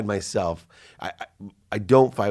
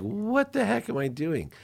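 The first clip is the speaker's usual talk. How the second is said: self-reproach; 13 semitones higher than usual. Retold or imitated. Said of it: "I'm like".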